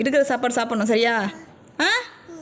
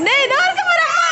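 calling out; 4 dB louder than usual. Real, not acted. Mother calls to her crying child that she is here, calling out.

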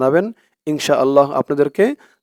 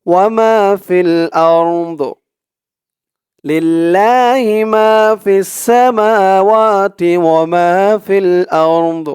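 slow, chanted tajweed recitation; drawn-out Quranic chant; 3 semitones higher, 3 dB louder, much slower than usual. Shown as a demonstration. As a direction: recite it slowly in melodic tajweed. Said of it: "teacher recites full model".

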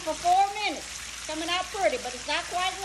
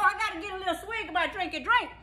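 usual speaking voice

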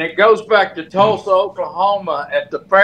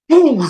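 mock boom shout; 10 semitones higher, 4 dB louder than usual. Retold greeting ritual with Duggan.

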